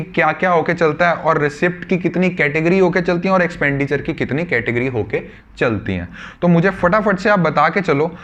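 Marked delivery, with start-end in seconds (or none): none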